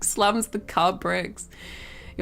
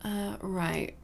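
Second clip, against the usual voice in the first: ordinary talk, nothing marked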